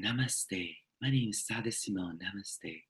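hushed parody of a solemn spiritual teacher; mock zombie Zen. Parodying solemn zombie Zen with a soft, low greeting. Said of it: "this zombie Zen".

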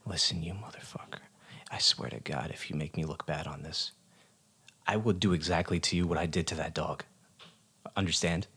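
hushed threat through the teeth; menacing whisper. Acted. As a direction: lean in and threaten in a low hiss. Off-mic threat to interviewer.

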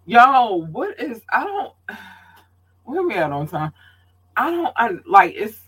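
high-pitched, flustered false starts with a sigh; real exasperation, lost for words; exasperated. Real, not acted. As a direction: sputter in disbelief, voice jumping up. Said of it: "oh, it's maddening".

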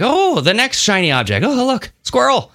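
high-pitched, hammed-up wonder; mock distracted excitement. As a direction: squeal like someone chasing every shiny distraction, mockingly. Mocks easily distracted attention seekers.

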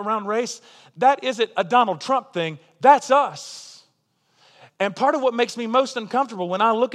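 fervent preacher driving the point home; preaching at full force. Acted. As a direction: build the sermon to a passionate peak. Preacher building to a climax.

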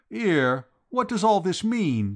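high, loud Cockney protest; indignant demand. A narrator's reading. Vowles demanding an explanation from the company, indignant. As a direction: demand an answer, voice shooting up. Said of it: "demanded Vowles".

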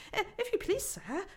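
high, timid beaver voice; polite curtsying creature; Missus Beaver. A narrator's reading. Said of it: "said Missus Beaver".

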